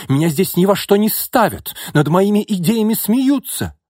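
very high, loud aggrieved shout; crying out. A narrator's reading. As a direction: shout your wounded grievance. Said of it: "выкрикнул Яков". (обиженно выкрикнуть)

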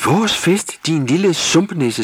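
high, loud story-voice scream; outraged, high-pitched yell; indignant shriek. A narrator's reading. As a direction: shriek the insult at full pitch. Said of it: "skreg Tulle".

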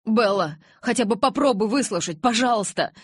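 loud, high, urgent plea; imploring. A narrator's reading. Jacob begs furious Bella to listen, imploring. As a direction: beg her to hear you out. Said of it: "взмолился Джейкоб".

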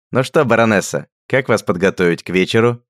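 loud teasing mockery; mocking. A narrator's reading. Richard mocking Radgar in a dress, loud teasing. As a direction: tease a friend in a dress. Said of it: "раздался насмешливый голос".

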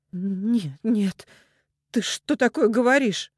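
high, shocked denial; rising horrified disbelief; not her own voice. A narrator's reading. Mother refuses news of her son's death, horrified. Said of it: "говорила не своим голосом".

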